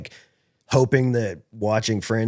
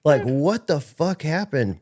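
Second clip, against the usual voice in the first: higher-pitched, slow mock outburst, dragging out dude; higher-pitched freaked-out yell at the friend; mock angry yell; 5 semitones higher, slower than usual. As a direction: replay the startled shout at a buddy. Yelling at friend, startled.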